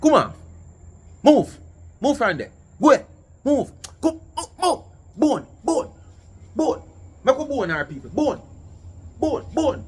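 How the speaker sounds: loud, high commands to a dog; calling a dog. Real, not acted. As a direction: bark orders at the dog. Shouting at dog.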